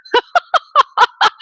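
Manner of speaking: high, loud burst of laughter; bright giggling outburst; real laugh. Real, not acted. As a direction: crack up at her own slip. Laughs at her own made-up word.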